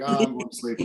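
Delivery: whispered, drowsy voice for the dog; sleepy dog voice. Pretend. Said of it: "He's like".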